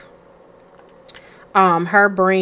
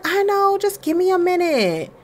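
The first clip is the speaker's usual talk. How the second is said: pitched-up pleading wife; high wife voice; 11 semitones higher than usual. Acted. Wife asking husband for a minute, high pleading voice. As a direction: voice the wife higher, asking for more time.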